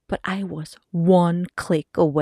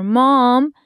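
a hint of a whining kid, louder; calling child; 3 semitones higher, 6 dB louder, slower than usual. Daughter comes round the corner, calling hungrily. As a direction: call out to mom, lightly childlike.

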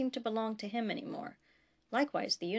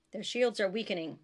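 ordinary delivery, nothing marked

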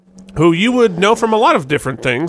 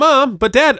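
lightly mocked kid's pleading, pitched up; mimicking pleading kid; 7 semitones higher than usual. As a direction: echo a kid pestering the parents.

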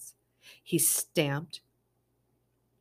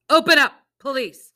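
loud, higher police shout; 10 semitones higher, 14 dB louder than usual. Acted. Officer shouting at the family through the door. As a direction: bark the order through the door. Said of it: "shouted a woman's voice".

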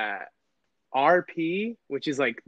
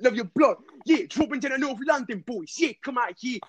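high-pitched London grime patter; grime-style London accent; 8 semitones higher than usual. Shown as a demonstration. Friend calls out the North London boys, London grime voice. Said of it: "Just like grime".

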